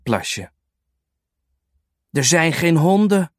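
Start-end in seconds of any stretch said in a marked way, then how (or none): none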